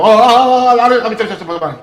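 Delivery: loud frantic mock babbling, oh oh oh; frenzied mock possession, cries of oh; mock possessed babble. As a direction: ham up a possessed person shouting to run. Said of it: "Bukan dia berbahasa kayak".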